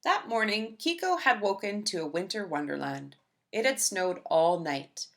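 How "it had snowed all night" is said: plain reading voice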